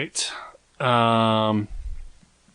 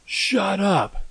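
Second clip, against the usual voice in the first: mock kid yelling; 9 semitones higher, much slower than usual. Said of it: "'cause they said".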